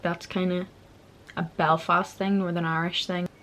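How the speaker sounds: usual speaking voice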